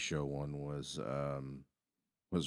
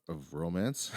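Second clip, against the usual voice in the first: normal talking voice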